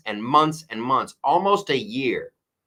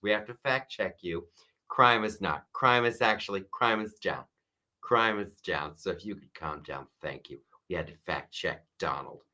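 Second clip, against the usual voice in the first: much softer, patronising hush; condescending moderators; 4 semitones lower, 6 dB quieter than usual. Retold or imitated. Host mocks debate moderators fact checking Trump, soft patronising hush. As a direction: talk down soothingly like calming a child. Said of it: "And they're like".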